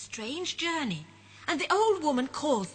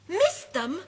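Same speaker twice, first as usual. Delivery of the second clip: higher, louder startled question; surprised cry; 7 semitones higher, 6 dB louder than usual. Acted. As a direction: echo it in disbelief. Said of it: "cries Rupert".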